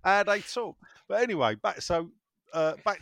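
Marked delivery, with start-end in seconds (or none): 0.0-0.8 mock Lancashire, raised in pitch; comic nasal Lancashire demonstration; pinched Lancashire accent. Shown as a demonstration. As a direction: insist on it in a squeezed Lancashire twang. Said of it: "then you get the Lancashire accent".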